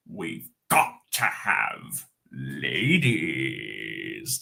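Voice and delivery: a hint of Gollum. Trying a Gollum voice for the chat. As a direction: drag it out like a creature. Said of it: "say it in the Gollum voice".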